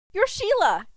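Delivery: high, loud, thrilled cry; an excited girl recognizing her hero; excited exclamation. A narrator's reading. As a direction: burst out with fan-girl delight. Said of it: "Kelly exclaimed".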